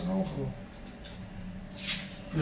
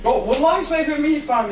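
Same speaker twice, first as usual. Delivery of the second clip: defensive protest; 8 semitones higher, 5 dB louder than usual. Acted. Defending himself in the argument.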